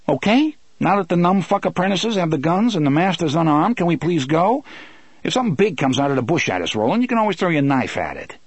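high, fast-talking character voice; sarcastic Eddie. Acted. Eddie urging Roland to get going, sarcastic. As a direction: rattle it off in irritated sarcasm.

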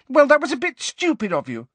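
high, loud angry retort. A narrator's reading. Ginny tells Harry off for shutting her out, angry. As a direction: tear into him angrily. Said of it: "said Ginny angrily".

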